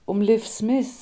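plain reading voice

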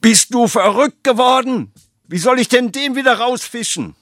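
high, loud outraged cry; shocked scolding father. Acted. The father scolds Franz for throwing the dice, high and loud. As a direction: yelp at the boy in alarm.

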